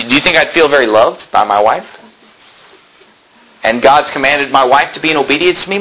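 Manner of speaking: ordinary talk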